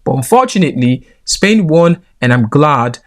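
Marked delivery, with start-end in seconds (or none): none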